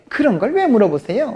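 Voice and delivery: mock standoffish rebuff; cold, curt. Salon owner rebuffing the pastor's question, mock cold. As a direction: imitate a frosty brush-off. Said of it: "좀 쌀쌀맞게".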